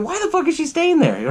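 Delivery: high disbelieving voice; incredulous thought. Retold or imitated. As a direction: ask it in disbelief.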